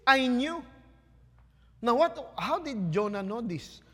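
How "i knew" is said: Jonah's retort. Acted. Jonah's sulky retort voiced.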